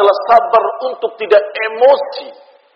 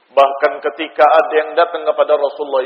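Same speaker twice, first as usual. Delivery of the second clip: usual speaking voice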